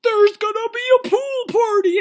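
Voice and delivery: high, loud, whooping reveal; mock hyped announcement. Host revealing the replacement party to women, mock hyped. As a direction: yell the big surprise like a party host.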